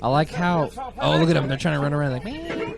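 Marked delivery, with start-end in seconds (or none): 2.2-2.7 high mock meow. Imitating ostriches running around.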